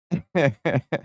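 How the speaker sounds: high chuckle; laugh. Real, not acted. Streamer amused by own gatekeeper bit, chuckling.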